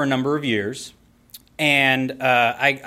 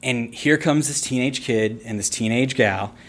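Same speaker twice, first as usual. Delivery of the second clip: ordinary talk, nothing marked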